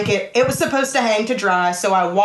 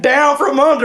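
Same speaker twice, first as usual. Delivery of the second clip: imitated Louisiana Creole from down in the throat; hammed-up Louisiana Creole accent; 4 semitones higher, 4 dB louder than usual. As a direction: mimic Louisiana Creole from the bottom of your voice. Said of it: "from the bottom of your voice".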